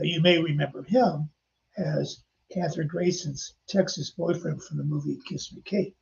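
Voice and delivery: ordinary talk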